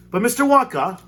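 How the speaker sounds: high, loud excited shout. Acted. Boy shouts eagerly at Mister Wonka, high and loud. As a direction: call out eagerly to the candy maker. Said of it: "he shouted".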